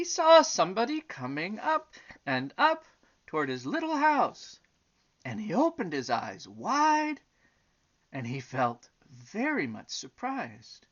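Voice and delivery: lilting, emphatic storybook narration; story reading to children. A narrator's reading. Reading the elephant and butterfly story aloud, storytelling. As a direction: read it out with sweeping pitch on key words.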